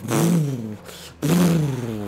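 drawn-out engine roar; imitated exhaust noise. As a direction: imitate a huge exhaust blasting.

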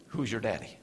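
low, soft haunting question; a muted memory of taunts; hushed echo. Acted. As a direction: let it hang quietly.

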